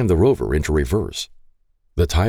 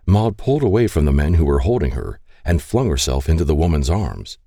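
ordinary delivery

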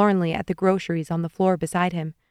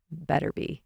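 low, quiet tease; teasing; 3 semitones lower, 9 dB quieter than usual. A narrator's reading. Carl answers Nicole's promise, teasing quietly. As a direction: drop it softly as a tease. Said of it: "he teased".

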